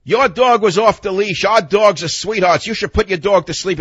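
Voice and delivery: imitating a scream. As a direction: mimic the girlfriends screaming back. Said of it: "they scream at me".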